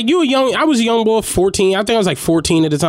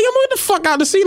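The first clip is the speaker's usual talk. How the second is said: mock bark at kid; faster than usual. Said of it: "I come up like".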